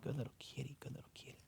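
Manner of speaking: soft whispered praise; hushed pet whisper; whispering to a cat. Real, not acted. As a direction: breathe the words to the kitty.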